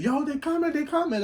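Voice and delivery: high, urgent yelled warning; kids yelling. Retold or imitated. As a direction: shout it like a scared kid warning everyone. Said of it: "the kids start yelling".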